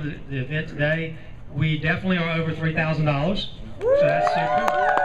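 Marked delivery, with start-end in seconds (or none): 3.8-4.9 excited. Real, not acted. Host cheering fundraising total.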